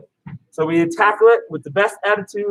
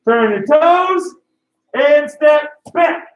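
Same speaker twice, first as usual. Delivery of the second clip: drill call; 6 semitones higher, 3 dB louder, slower than usual. Shown as a demonstration. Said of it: "instructor counts drill".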